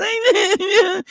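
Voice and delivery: high laugh. Real, not acted. Laughs at own mockery.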